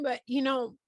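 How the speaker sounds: ordinary talk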